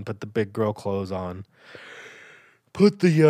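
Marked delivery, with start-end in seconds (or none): 2.7-3.3 mid-yawn. Real, not acted. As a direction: yawn breaking the words. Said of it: "sorry for the yawn".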